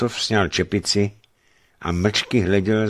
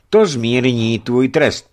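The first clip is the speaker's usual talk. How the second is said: raised, slow, grave pronouncement; 4 semitones higher, 6 dB louder, slower than usual. A narrator's reading. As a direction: say it slowly and seriously. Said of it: "pravil vážně Nikita". (vážně a pomalu)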